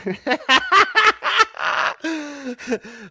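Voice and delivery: long high laughing fit; real high, helpless laughter; cracking up. Real, not acted. Host cracks up at looking like a peasant, laughing hard.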